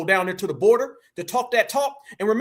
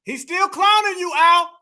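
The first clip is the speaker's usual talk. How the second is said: higher, louder jeer at Al; mocking shout; 6 semitones higher, 5 dB louder than usual. Real, not acted. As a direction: crow it at him, loud. Mocking Al over the clip.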